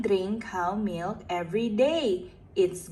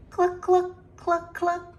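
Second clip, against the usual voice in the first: high clucking hen sounds; chicken clucking; 8 semitones higher than usual. Pretend. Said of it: "Can you guess what sound is this".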